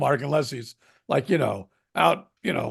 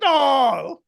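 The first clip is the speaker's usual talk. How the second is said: Sergeant Schultz; 9 semitones higher, 5 dB louder than usual. Acted. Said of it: "that was Sergeant Schultz".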